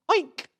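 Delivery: a sudden high mock oink; pig oink. Comic tossing a pig noise at the crowd. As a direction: squeak out a silly oink.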